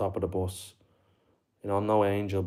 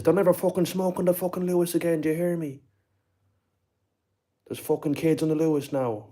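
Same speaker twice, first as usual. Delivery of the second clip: high-pitched, worked-up mock Limerick rant; Limerick accent; 8 semitones higher, 3 dB louder than usual. Culchie hitting the smoker with a hammer, high mock Limerick accent. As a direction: imitate an irate Limerick man, voice pitched right up. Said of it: "he sounded like he was from Limerick".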